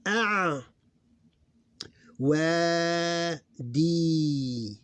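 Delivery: "A, wa, di" loud and high, each held; syllable by syllable. Shown as a demonstration. Teacher sounding out an example, drawn-out syllables. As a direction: stretch out "wa" and "di" separately.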